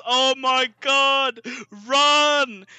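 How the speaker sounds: retold mum's alarmed shout to run; panicked yell. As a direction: shout to the daughter to flee. Mum sees soldiers eaten.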